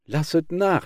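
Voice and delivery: a desperate crow crying out, high and loud. A narrator's reading. Crow crying out to the attacking peacocks, high and loud. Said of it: "schrie sie endlich".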